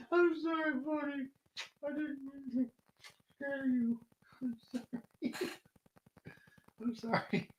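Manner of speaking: high soft doggy-voice apology; pet talk. Real, not acted. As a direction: coo an apology to a scared pup. Soothing the startled dog.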